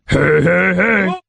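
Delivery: deep booming cartoon greeting; Fat Albert voice. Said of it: "[name]'s fake Fat Albert".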